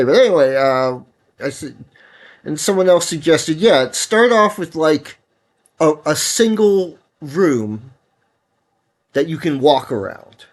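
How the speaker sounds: usual speaking voice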